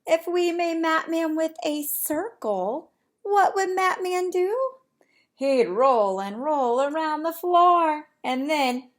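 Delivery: bright, lively storybook reading; animated read-aloud. Acted. Teacher reading to her class, lively storybook voice. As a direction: read it big for the little ones.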